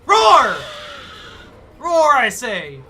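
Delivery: loud, slow monster roar; alien roar. Pretend. Player roaring as the alien at a survivor, loud and slow. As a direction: bellow it out like a hungry alien. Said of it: "Alien noises".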